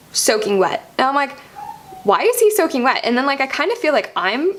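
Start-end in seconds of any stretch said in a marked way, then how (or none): 2.0-3.0 higher-pitched, retold bewilderment; mildly acted puzzled disbelief; baffled question. Woman recalling looking down at her soaking boyfriend, baffled.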